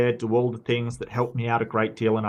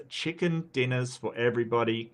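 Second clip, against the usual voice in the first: ordinary talk, nothing marked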